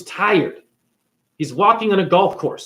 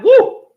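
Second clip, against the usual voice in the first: loud, high-pitched "woo"; excited little cheer; high whoop; 8 dB louder than usual. Real, not acted. Whoop at mention of an actor.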